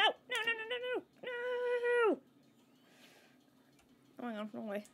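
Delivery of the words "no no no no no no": very high noes into a long wail; panicked squeal. Real, not acted. Panicking as game goes wrong.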